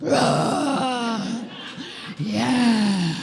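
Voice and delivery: mock monster roar, drawn out; inner Hulk. Client hitting the futon with a bat, mock Hulk roar. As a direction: roar it like a raging beast. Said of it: "the inner Hulk is like".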